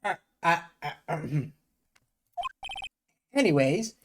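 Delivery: put-on high, loud old-timey gangster lawyer with see; Grossberg voice. Gamer voicing the old lawyer meeting the young attorney, high and loud. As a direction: hammer each see up high like a wiseguy.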